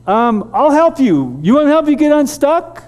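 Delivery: high, loud calling out; shouting across. Retold or imitated. Calling to the stuck neighbor through the trees, a loud high shout. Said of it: "I shout".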